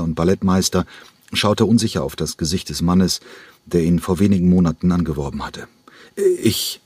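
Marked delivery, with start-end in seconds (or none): 6.2-6.8 stammering. Acted. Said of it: "stammelte er".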